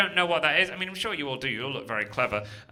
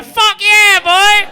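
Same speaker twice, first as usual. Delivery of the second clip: much higher, loud whooping yell; ecstatic cheer; 16 dB louder, much slower than usual. Acted. Comedian celebrates the Transylvanian, whooping loudly. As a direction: explode with joy. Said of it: "you were the happiest man alive".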